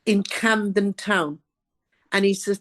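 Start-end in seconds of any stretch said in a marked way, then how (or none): 0.0-1.4 slow, measured place name; real slow. Retold or imitated. Scottish man telling a newcomer where to go, real slow. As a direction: imitate careful, drawn-out directions. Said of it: "had to talk real slow".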